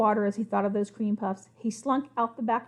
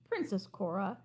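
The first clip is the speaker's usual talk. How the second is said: soft, disappointed sad voice; 2 semitones lower, 9 dB quieter than usual. A narrator's reading. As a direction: sigh it out, let down. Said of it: "in the sad voice".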